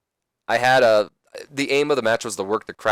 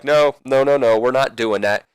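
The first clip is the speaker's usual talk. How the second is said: louder, higher, rapid string of protests; acted refusal; 4 semitones higher, 6 dB louder than usual. Wrestler objects to the match terms, louder mock refusal. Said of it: "Kurt's just shaking his head no".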